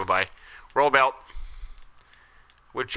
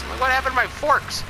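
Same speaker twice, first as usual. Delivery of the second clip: high bewildered; 13 semitones higher than usual. Real, not acted. Discovers forks missing.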